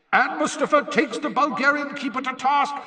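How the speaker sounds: high, loud stadium announcer; excited match commentator's shout; Bagman commentating. A narrator's reading. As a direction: project it to a roaring crowd, high-pitched. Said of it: "Bagman informed the roaring spectators".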